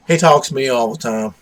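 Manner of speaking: ordinary delivery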